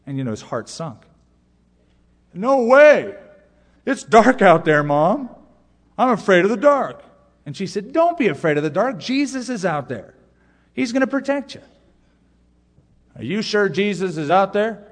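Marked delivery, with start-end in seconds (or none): 2.3-7.0 put-on high, loud frightened child's protest; a boy refusing to fetch the broom from the dark porch; scared little boy. Scared boy voiced in story. 7.9-11.6 reassuring mom. Retold or imitated. Mother reassures son in story. 13.1-14.8 put-on doubtful boy. Boy asks mother in story.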